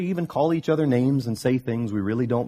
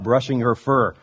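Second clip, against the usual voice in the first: usual speaking voice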